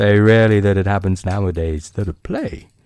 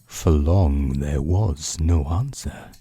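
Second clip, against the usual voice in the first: hushed, unhurried bedtime reading; quiet storyteller building suspense; story narration; 3 semitones lower, 3 dB quieter than usual. A narrator's reading. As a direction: read it like a book by the bedside.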